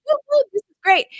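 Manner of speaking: high, fast cheering; giddy cheer. Retold or imitated. Mom imitating her own giddy cheering at the table, high and fast. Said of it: "I'm like".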